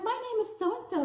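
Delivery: put-on Texas accent. Blonde girl introducing herself to me, high Texas twang. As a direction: imitate a friendly girl with a big Texas accent. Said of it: "with this big old Texas accent".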